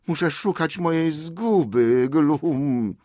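high creature whine ending in a gulp; fretful Gollum. Acted. Gollum must search for his loss, whining. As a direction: whine it, then gulp in the throat.

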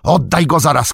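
high, loud demand; sudden shout. A narrator's reading. Frodo demands the Ring from Sam, shouting. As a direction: shout the order abruptly. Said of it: "krzyknął".

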